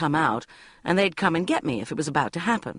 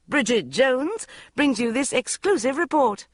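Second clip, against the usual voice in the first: TV announcer; 7 semitones higher than usual. A narrator's reading. Quoting TV headline.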